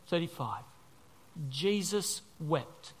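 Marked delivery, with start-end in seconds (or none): 1.5-2.9 slow weighty. Real, not acted. Weighty reading of verse.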